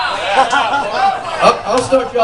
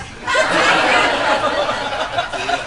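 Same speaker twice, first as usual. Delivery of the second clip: breathy real laughter after the joke; enjoy your own punchline; laughing; 8 semitones higher, 4 dB quieter than usual. Real, not acted.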